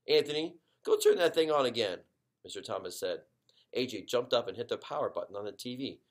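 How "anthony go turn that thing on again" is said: louder, higher command; dad ordering his son, louder; calling out. Acted. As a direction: send the boy to the set.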